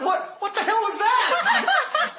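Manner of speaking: high, slow, laughing disbelief; shocked disbelief. Retold or imitated. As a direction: still reeling from the scare, drag it out. Shocked after being scared.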